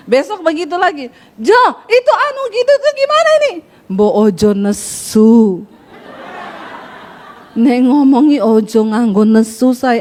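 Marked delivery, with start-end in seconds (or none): 1.4-3.5 high, urgent call; high-toned Sulawesi wife. Acted. Wife calling her Solo husband again, high and insistent. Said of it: "nadanya tinggi". 3.9-10.0 low, drawn-out Javanese plea; slow Solo husband. Acted. Solo husband telling his wife not to be angry, slow and low. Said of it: "Orang Solo kalau ngomong pelan, halus".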